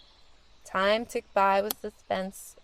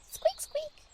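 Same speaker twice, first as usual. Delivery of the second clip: very high, soft little squeaks; mouse squeak; 7 dB quieter than usual. Acted.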